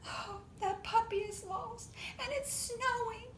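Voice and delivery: high, weepy wail; high crying voice. Acted. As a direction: sob it out high like a heartbroken little monkey. Monkey weeping at sad story.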